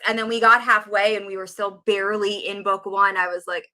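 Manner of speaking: ordinary talk, nothing marked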